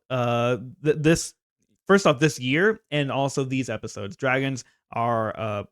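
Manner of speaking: usual speaking voice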